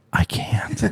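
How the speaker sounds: whispered, soft and spent; defeated whisper. Retold or imitated. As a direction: barely breathe it out, like she's given up. Wife quoted giving up.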